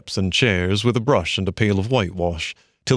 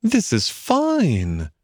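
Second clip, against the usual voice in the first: higher, slow, savouring it; pleased mole; 7 semitones higher, much slower than usual. A narrator's reading. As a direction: content little mole. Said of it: "he said to himself".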